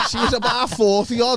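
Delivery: high, giggling admission; owning up through laughter; laughing confession. Real, not acted. Confessing his fling to mates, giggling and high.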